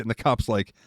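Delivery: ordinary delivery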